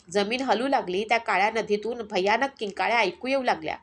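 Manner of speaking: ordinary delivery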